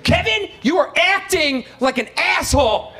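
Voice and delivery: imitating dad losing patience; scolding dad. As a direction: snap at your son, shocked at yourself. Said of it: "My dad is like".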